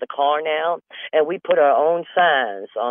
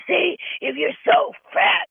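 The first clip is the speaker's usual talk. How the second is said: mock squeal; 14 semitones higher than usual. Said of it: "why would I go".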